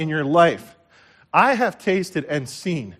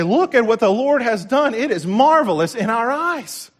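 high, loud, exultant praise; psalmist's praise; 8 semitones higher, 5 dB louder than usual. Retold or imitated. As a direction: proclaim it like the psalmist. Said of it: "like the psalmist, I say".

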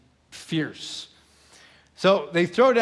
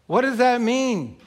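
ordinary talk, nothing marked

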